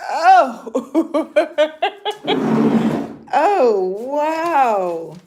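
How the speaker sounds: loud, high laughing surprise; astonished, laughing, stretching out the wow; real amazement. Real, not acted. Amazed reaction to ten inches of snow.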